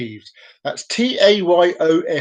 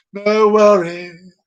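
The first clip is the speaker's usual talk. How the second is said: slow, drawn-out silly voice; a slow goofy character voice; put-on cartoon voice; 2 semitones higher, 4 dB louder, much slower than usual. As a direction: stretch it like a cartoon sidekick.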